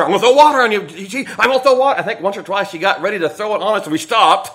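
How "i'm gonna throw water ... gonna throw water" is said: mom threatening. Retold or imitated. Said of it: "she'd throw water".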